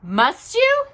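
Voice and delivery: high, polite rabbit voice; high Rabbit voice, politely. A narrator's reading. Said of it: "said Rabbit politely".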